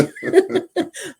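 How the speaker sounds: a high burst of laughter at herself. Real, not acted. Laughing at her own joke about the neighbors, high giggle.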